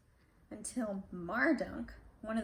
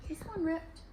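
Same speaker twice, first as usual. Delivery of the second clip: dismay; 7 semitones higher than usual. Real, not acted. Page torn.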